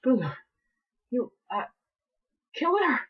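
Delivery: high, rising horrified question; horrified disbelief. Acted. Captive filly asks the mayor, high and horrified. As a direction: gasp it out in disbelief.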